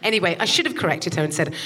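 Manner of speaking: ordinary talk, nothing marked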